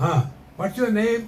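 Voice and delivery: lightly acted official, high lilt; a hint of heavenly gatekeeper; questioning gatekeeper. Acts second gatekeeper questioning.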